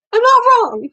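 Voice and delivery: high and loud, fiercely insistent; indignant yell. Real, not acted. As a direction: shout back that you're right. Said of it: "He needs anger management training".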